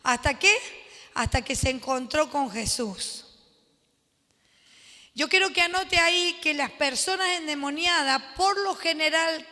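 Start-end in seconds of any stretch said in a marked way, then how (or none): none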